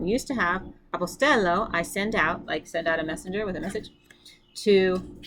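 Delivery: usual speaking voice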